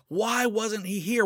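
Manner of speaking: high, with WHY tossed up into a rising question; pleading question. Retold or imitated. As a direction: ask it again as a desperate plea. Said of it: "she was pushing him for answers".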